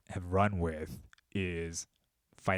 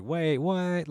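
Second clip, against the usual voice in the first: higher, a mock double take; surprised; 8 semitones higher than usual. Podcaster recalling his shock at Trump's Zionism, higher mock surprise. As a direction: retell the moment of disbelief, pitch jumping up.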